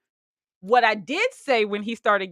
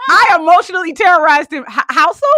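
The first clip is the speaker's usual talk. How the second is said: higher, louder wait a minute; laughing indignant protest; mock outrage; 9 semitones higher, 10 dB louder than usual. Real, not acted. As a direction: protest in playful disbelief. Playful outrage at accusation.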